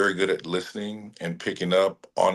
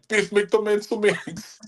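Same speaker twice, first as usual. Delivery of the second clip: put-on high, slow Kiwi accent quote; New Zealand accent; 14 semitones higher, slower than usual. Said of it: "pulling out the New Zealand accent".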